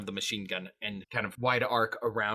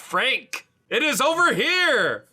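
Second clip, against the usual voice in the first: high, loud directing yell; =hammed-up shout across a distance; calling out; 11 semitones higher, 5 dB louder than usual. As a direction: holler so he can find you. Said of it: "we'll call out him specifically and say".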